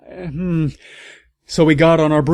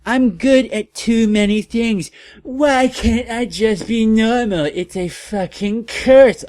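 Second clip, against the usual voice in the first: much higher, louder whiny cry; mock shout; 10 semitones higher, 4 dB louder than usual. Ebony complaining to Draco, higher mock whiny shout. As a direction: wail it like a dramatic teen. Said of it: "I shouted".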